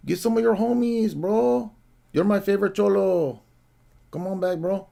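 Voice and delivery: high-pitched exaggerated Mexican bro accent; mock Mexican accent. Host calling the departed caller back, mock Mexican accent. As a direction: taunt him in a high, fake Mexican accent. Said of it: "I can't even do the accents right".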